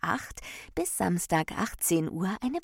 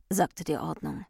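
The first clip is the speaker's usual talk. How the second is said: usual speaking voice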